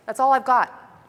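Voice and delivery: ordinary talk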